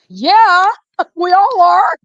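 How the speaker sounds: high-pitched shout breaking into laughter; loud high outburst. Real, not acted. Joking outburst while laughing.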